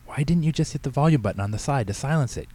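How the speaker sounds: put-on embarrassed teen's urgent complaint; hissed. A narrator's reading. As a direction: hiss it at Dad, mildly exasperated. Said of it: "Katie hissed".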